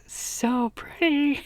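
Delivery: slow high swoon; dreamy gush. Real, not acted. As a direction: swoon over it slowly. Drawn-out gush.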